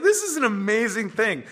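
incredulous, mock-amazed exclamation; mocking amazement. Blind man laughing at the Pharisees, mock amazed. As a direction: marvel at them half laughing. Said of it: "saying it with that tone of just laughing at them".

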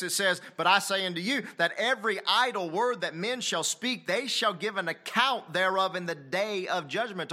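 loud, high-pitched, driving sermon; full-force preaching. Acted. Preacher warning the church about every idle word, full-force preaching.

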